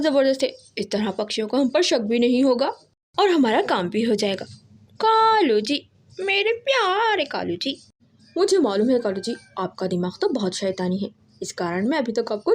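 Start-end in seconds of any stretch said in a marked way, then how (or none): 5.0-7.8 higher, slow, syrupy sweet; fawning. A narrator's reading. Wife sweetly praising her husband's clever plan, fawning. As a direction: coo his name like a doting wife.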